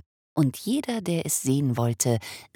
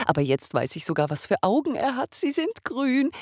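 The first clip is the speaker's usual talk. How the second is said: fast and much higher; delighted rush; 13 semitones higher, 3 dB louder than usual. Acted. Father thrilled by his son's green eyes, excitedly fast. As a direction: gush with joy.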